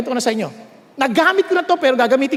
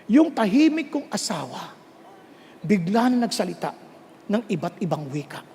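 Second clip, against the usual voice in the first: usual speaking voice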